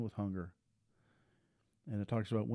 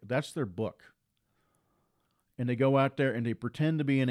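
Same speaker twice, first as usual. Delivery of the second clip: raised, emphatic pulpit delivery; full-force preaching; 3 semitones higher, 5 dB louder than usual. Acted. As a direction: drive each line home to the congregation.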